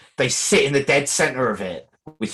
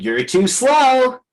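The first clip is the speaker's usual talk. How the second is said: higher, louder, very slow taunt; Sonic voice; 11 semitones higher, 4 dB louder, much slower than usual. Retold or imitated. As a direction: tease them like the hedgehog speeding past. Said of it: "full pelt in Sonic's voice".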